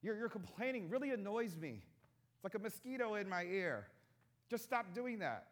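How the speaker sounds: mock annoyed God. Preacher imagining God scolding grumbling Israelites, mock irritated. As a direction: play an exasperated deity swatting away complaints. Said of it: "Is God just saying".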